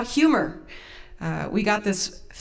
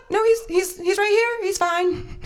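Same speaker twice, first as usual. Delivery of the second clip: high, puzzled mom voice; baffled mom; 12 semitones higher than usual. Retold or imitated. Mom reassures daughter about the dog, baffled high voice. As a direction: answer as a mother who sees no emergency. Mom's baffled reply acted.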